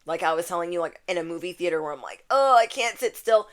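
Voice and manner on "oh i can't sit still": higher, louder fidgety whine; mock antsy outburst; restless complaint. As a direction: squirm out a lightly acted complaint. Mimics own restlessness in movie theater.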